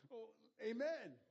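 gasp, then a loud, high, drawn-out amen; startled awake. Retold or imitated. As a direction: act out jolting awake and covering for it. Acts out jolting awake at prayer's end.

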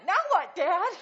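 put-on high, loud child full of expectation; excited little boy asking dad what comes next; eager little boy. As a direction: ask like a kid waiting for the next trick. Boy eagerly asks his dad.

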